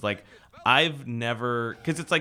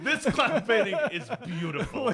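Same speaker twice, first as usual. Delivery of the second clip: mock admiring; 10 semitones higher than usual. Mocking admiration of clown.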